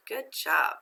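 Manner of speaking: normal talking voice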